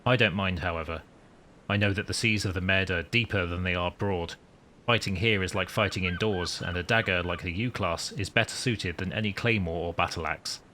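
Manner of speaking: deliberate, composed storytelling; captain's log narration. Acted. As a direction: narrate it like a submarine commander's log.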